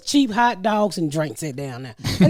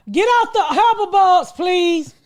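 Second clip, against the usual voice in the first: shouting; 10 semitones higher, much slower than usual. Real, not acted. Yelling at caller's earbuds.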